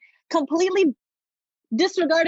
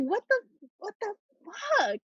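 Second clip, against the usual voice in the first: stunned disbelief; 9 semitones higher than usual. Retold or imitated. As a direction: drag it out in shock, pitched up. Shock at being bitten.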